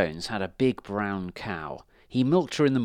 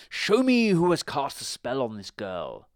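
raised, incanting wizard voice; wizard's command; 4 semitones higher, 4 dB louder than usual. A narrator's reading. Wizard to his crystal ball, commanding incantation. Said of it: "he cried".

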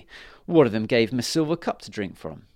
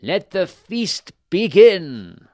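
high, slow, loud royal proclamation; booming out the king's command; fairy king; 8 semitones higher, 11 dB louder, much slower than usual. A narrator's reading. King rings a silver bell, opens feast: high slow loud call. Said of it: "He said".

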